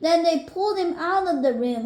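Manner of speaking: ordinary delivery, nothing marked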